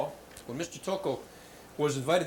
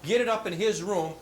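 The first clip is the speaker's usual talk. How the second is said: ordinary talk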